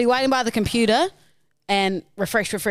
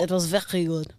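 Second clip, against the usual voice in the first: mock French accent; English with French-style throaty r; 3 semitones lower, 3 dB quieter than usual. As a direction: playfully copy the caller's French accent. Echoes caller's French accent.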